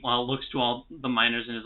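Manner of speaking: ordinary talk, nothing marked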